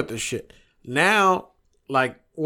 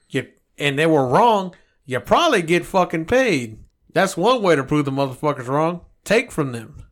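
usual speaking voice

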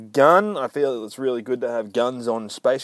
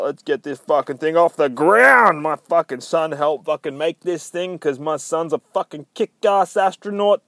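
lightly voiced bragging dad, raised pitch; boastful father; 5 semitones higher, 5 dB louder than usual. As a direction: brag loudly about your son. Boastful father's spiel acted out.

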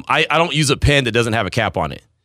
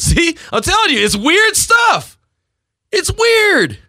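pitched-up laughing insistence; emphatic insisting; much slower than usual. Real, not acted. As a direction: drive the point home. Insisting pet peeves are weird.

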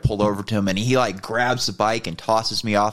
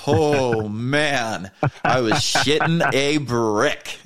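drawn-out, higher exclamation with a laugh; retold huge relief; 4 semitones higher, much slower than usual. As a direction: let the relief out slowly, pitched up. Relives his relief after the scare.

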